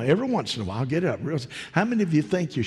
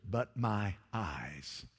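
softer, lower, slow hush; hushed awe; 3 semitones lower, 9 dB quieter, much slower than usual. Acted. Quiet echo of Isaiah's words.

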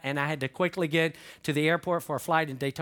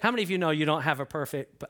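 ordinary talk, nothing marked

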